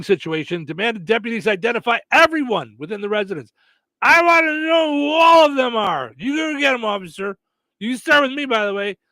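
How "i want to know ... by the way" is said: put-on high, loud drunken demand. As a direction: bark it at the deputies. Caller demanding of deputies.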